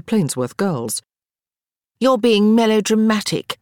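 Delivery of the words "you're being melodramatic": dismissive. A narrator's reading. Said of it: "Harriet replied".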